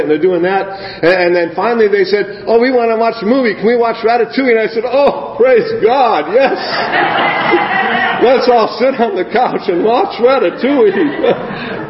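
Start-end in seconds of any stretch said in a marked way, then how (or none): 2.5-4.6 put-on eager kids. Kids asking, voiced high. 4.8-6.6 relieved delight. Retold or imitated. His relieved answer to kids. 6.6-8.2 a high airy giggle; high breathy laugh. Real, not acted. Preacher laughing at his own relief. 8.3-11.9 laughing. Real, not acted. Laughing while speaking.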